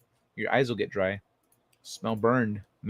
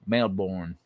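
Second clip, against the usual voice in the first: Texan-sounding Australian try; 6 semitones lower, 4 dB quieter than usual. Shown as a demonstration. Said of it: "That's a little too Texan".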